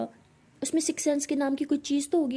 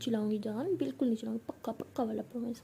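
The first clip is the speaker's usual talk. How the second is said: quietly reassuring, trailing softer; soft promise; 3 semitones lower, 7 dB quieter, faster than usual. Acted. Pihu swears she won't shout, soft promise. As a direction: murmur a tender promise.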